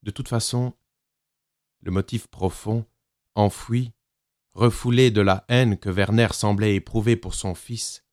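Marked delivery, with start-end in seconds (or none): none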